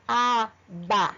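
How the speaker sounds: "Aba" broken into separate syllables, a, ba; deliberate, separated "Aba"; every letter audible. Shown as a demonstration. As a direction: sound out "Aba" slowly, syllable by syllable. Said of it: "E dá pra ouvir todas".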